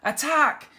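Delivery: high, loud battle cry; captain leading the attack. A narrator's reading. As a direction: shout the charge. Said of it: "cried Captain Flynn".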